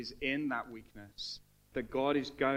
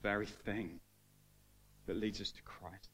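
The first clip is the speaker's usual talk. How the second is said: prayer; 2 semitones lower than usual. Acted. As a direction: pray over the room gently. Leads closing prayer.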